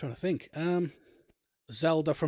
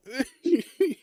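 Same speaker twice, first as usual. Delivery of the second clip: high squealing laugh; a real burst of high laughter; 12 semitones higher, 5 dB louder than usual. Real, not acted. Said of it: "I'm crying".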